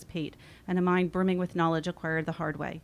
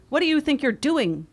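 loud, sharp bark; 8 semitones higher, 8 dB louder than usual. A narrator's reading. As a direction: snap at the kid angrily. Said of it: "Dan barked".

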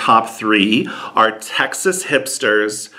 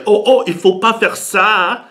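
mock fussy Frenchman; 9 semitones higher than usual.

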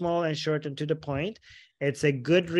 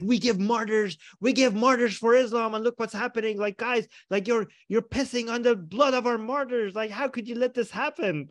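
a raised, pitched-up imitation of a zealot's outcry; mock zealot outcry; 7 semitones higher than usual. Guest mimicking religious protesters online, in a hammed-up higher outcry. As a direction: play the indignant believer, a bit higher and hammed up. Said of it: "They shout, they're like".

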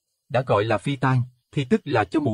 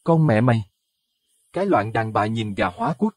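husband's curse; 4 semitones lower than usual. A narrator's reading. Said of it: "lên tiếng chửi".

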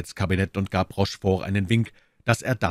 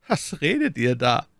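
mildly astonished cardinal; 8 semitones higher than usual. A narrator's reading. Cardinal hearing D'Artagnan's accusation of My Lady, astonished. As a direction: question it in disbelief, voice lifted. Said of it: "rief der Kardinal erstaunt".

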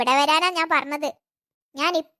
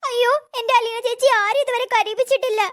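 put-on whiny kid; 7 semitones higher than usual. Whiny child complaint.

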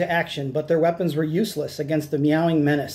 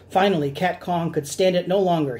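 ordinary delivery, nothing marked

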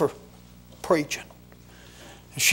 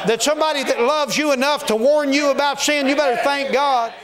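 higher-pitched, emphatic pulpit declaration; impassioned Southern preacher; fervent preaching; 5 semitones higher than usual. Real, not acted. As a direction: drive it home to the congregation. Preacher driving home his point.

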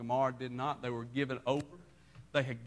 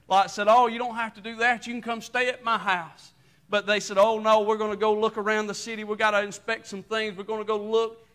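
high, loud, driving preacher voice; fervent revival preaching with acted dialogue; full-force preaching; 5 semitones higher, 6 dB louder than usual. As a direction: preach it hard to the pews.

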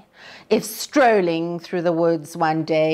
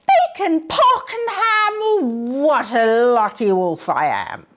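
a touch of a gloating wolf, louder; gleeful wolf; 6 semitones higher, 5 dB louder than usual. A narrator's reading. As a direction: savour the words like a greedy wolf. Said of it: "He shouted".

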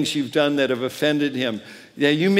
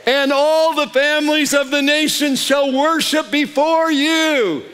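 much higher and louder, soaring verse; exultant proclamation; 11 semitones higher, 4 dB louder than usual. Acted. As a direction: lift it up with joy. Triumphant verse after laughter.

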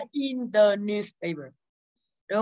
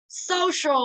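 Social with SO hit high and falling; stress on first syllable; 7 semitones higher than usual. Shown as a demonstration. Teacher models for students the stressed first syllable of Social. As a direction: punch SO in Social. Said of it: "trọng âm phải rơi vào âm đầu".

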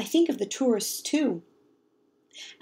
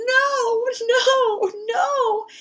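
high, loud sobbing cries; 14 semitones higher, 5 dB louder, much slower than usual. Acted. Daughter sobbing as Papa decides to cut the tree, crying out. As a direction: wail it through tears. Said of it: "I'm sobbing".

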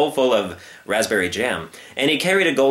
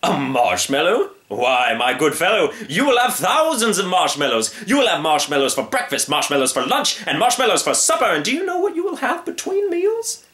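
eager showman; 7 semitones higher than usual. Acted. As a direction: sell him the dream. Circus man promising marshmallows.

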